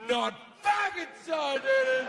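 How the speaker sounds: high-pitched, indignant shouted denial; angry retort. Acted. God correcting those who calm him, angry high retort. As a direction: snap back in acted outrage. God angrily contradicts the crowd.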